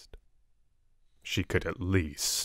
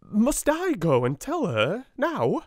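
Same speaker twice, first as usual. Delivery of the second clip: frightened; 13 semitones higher, 5 dB louder, slower than usual. A narrator's reading. Frightened Amelia pleads.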